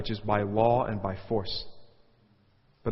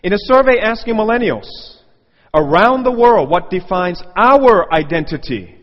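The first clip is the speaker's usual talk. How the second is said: much louder, higher, slowing speech-maker voice; mock grand speech; 6 semitones higher, 12 dB louder than usual. Preacher quoting a commencement speech, pompous and booming. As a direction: boom it out like a self-important orator.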